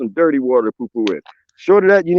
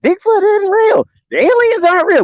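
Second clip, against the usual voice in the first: mock skeptic. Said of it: "I find somebody disagree".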